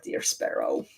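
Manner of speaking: ordinary talk, nothing marked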